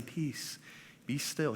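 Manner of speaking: soft, hushed "Peace, be still"; gentle version. Shown as a demonstration. Pastor showing what Jesus did not say, hushed. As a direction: say it softly, the polite way. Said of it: "He isn't saying".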